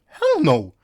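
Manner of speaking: loud, high outburst; mock outrage. Narrator objects to "it's over", loud outraged protest. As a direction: burst out in protest.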